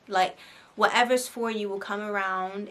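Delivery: normal talking voice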